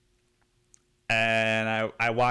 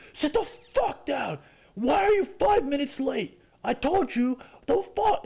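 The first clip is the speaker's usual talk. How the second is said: angry parent. Retold or imitated. Parent scolding the kid for being five minutes late, higher mock stern voice.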